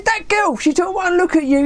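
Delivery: high-pitched, insistent retort; retold exasperation, pitched well up; worked up. Dave explaining who he means to his friend, worked up. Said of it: "He was so worked up".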